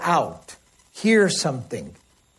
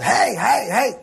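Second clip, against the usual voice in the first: higher-pitched repeated herding call; mock shepherd's yell; 5 semitones higher, slower than usual. Shepherd just yelling sounds at the animals, acted yelping call. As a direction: yelp it out like a herdsman. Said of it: "the shepherd would have just yelled".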